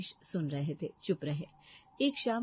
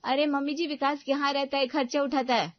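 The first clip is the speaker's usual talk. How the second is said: put-on loud, higher, sneering young wife; haughty daughter-in-law; 9 semitones higher, 5 dB louder than usual. Daughter-in-law to mother-in-law, haughty and loud. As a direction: put the old woman in her place.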